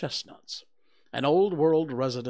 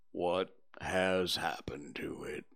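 low, slow, whispered and mournful; gloomy donkey Eeyore; 6 semitones lower, 5 dB quieter than usual. Acted. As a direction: sigh it out like a sad old donkey.

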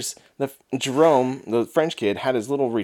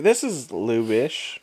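slow, dopey retold guess; mock drunk reasoning; 3 dB quieter, much slower than usual. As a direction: drag it out like a drunk. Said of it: "He's like".

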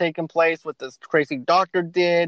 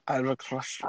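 soft mock Russian boxer line; hammed-up Russian threat, kept soft; Russian accent; 3 semitones lower, 10 dB quieter than usual. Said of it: "Like the Russian".

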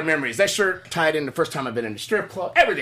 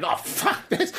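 much higher, exasperated; high disgusted quote; much slower than usual. Retold or imitated. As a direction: squeak it out in disgust. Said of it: "And I'm like".

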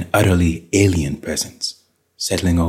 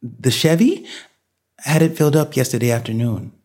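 higher-pitched voice of a bewildered woman; dazed wife; 6 semitones higher than usual. A narrator's reading. Dazed wife answers husband about the car, bewildered. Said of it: "Janet was dazed".

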